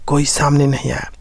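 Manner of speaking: ordinary delivery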